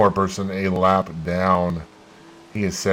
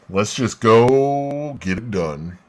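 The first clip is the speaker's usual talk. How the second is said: slow words through a yawn; stifling a yawn; slower than usual. Real, not acted. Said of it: "I can't stop yawning".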